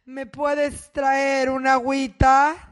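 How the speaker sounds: slow, syrupy husband voice; mock sweet-talking husband, slow and drawn out; husband asking a favor. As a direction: drag out a sweet request from bed. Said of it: "y diga".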